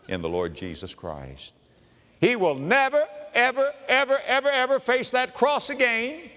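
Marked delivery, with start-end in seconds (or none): 2.2-6.3 preaching climax. Real, not acted.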